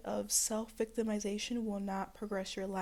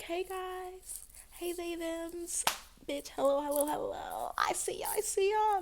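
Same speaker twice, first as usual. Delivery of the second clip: much higher, gushing hello to viewers; bubbly greeting; 7 semitones higher than usual. Pretend. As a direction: greet the whole audience brightly. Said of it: "I wanna be like".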